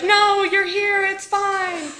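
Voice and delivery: high, slow mock reassurance; hammed-up comforting voice; reassuring rescuers. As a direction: play the rescuers calming us down. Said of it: "they're like".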